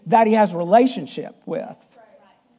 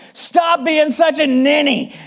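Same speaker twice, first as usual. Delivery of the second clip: blunt raised bark, slow; Clint Eastwood counseling; 8 semitones higher, 3 dB louder, slower than usual. Retold or imitated. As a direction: snap it out like a tough cowboy. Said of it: "That's Clint Eastwood counseling".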